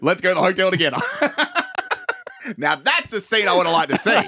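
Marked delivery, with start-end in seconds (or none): 1.0-2.5 high squealing laugh. Real, not acted. Cracking up at own skit.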